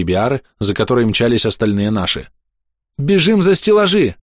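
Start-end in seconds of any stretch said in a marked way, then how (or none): 3.0-4.2 high urgent shout to run. Acted. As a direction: call out to flee. Urgent call to flee.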